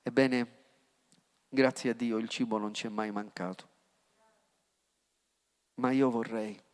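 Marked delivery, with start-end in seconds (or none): none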